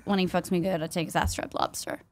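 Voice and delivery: quoting a lyric quietly. Retold or imitated. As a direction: read the lyric under your breath. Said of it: "a lyric that says".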